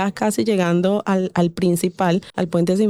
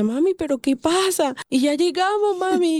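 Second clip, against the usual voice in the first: put-on high, childlike and bewildered; little girl's voice; 10 semitones higher than usual. Daughter asks her mother while they run across the border, high childlike voice. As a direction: mimic her small daughter, pitched high.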